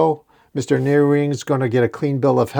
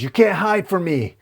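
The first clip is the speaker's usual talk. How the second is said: menacing scream; 7 semitones higher than usual. A narrator's reading. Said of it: "Carnage screams".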